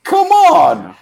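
high, loud cry of disbelief; soaring incredulous holler; exasperated shout. Retold or imitated. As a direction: yell it out in frustration. Exasperated cry at rude seller.

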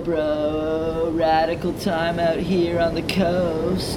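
loud laid-back surfer bro; surfer dude. Acted. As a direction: drawl it like a beach bro. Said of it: "the surfer voices".

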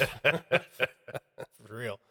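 chuckling through the words; laughing. Real, not acted. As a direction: laugh it out softly. Laughing at own joke.

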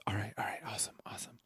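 gleeful secret whisper. Retold or imitated. Student whispering thanks to the teacher after the secret deal. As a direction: breathe it out, barely audible, pleased. Student pleased, sneaking donut plan.

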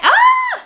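sudden high, loud shriek; piercing cry of surprise; startled scream. Real, not acted. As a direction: yelp out loud in real surprise. Startled mid-sentence by a prop.

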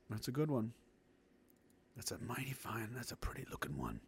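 hushed, breathy British-free whisper. Real, not acted. Admiring the hill, whispering to himself. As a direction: whisper it low like sharing a secret.

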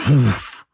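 breathy "woof" of gas igniting; imitated fireplace whoosh. Explaining to viewers how gas fireplaces light, imitated whoosh. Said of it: "the gas fireplace, it goes".

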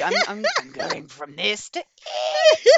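voice breaking. Real, not acted. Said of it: "I sound like a teenage boy whose voice is breaking".

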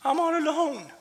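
high, whiny mock lament; mocking whine. Preacher mimicking Elijah's lie to the church, high whine. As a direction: mimic the self-pitying whine. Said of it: "accepted a lie as truth".

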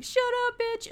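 very high-pitched angry outburst, imitated; mock angry yell. Danny silencing Vendetta before throwing her, mock angry yell. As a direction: squeal it out as a hammed-up furious order.

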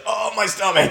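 high, pained mock whimper; whiny mock fighter. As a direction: play a fighter faking a stomach ache. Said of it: "he's like".

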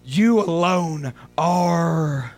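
low, hushed declaration. Acted. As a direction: drop to a near-whisper of awe. Soft worship after loud preaching.

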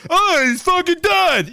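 high, slow exclamation; mock panicked shout. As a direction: cry out in hammed-up alarm. Acts officers discovering the death.

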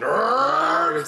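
high, loud nerdy whine; Revenge of the Nerds guy. Retold or imitated. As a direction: whine it like a cartoon nerd. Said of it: "remember the dude from Revenge of the Nerds".

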